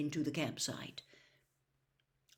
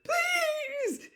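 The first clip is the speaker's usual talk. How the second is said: very high loud elf voice close to tears; tearful elf plea; 16 dB louder, much slower than usual. A narrator's reading. Elf begs Mister Crouch not to dismiss her, tearful squeal. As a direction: beg him, voice cracking high. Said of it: "her eyes brimming with tears".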